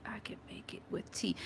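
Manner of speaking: barely audible, whispered to oneself; whispered reading. Real, not acted. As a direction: read a comment under your breath. Reads viewer comment to herself.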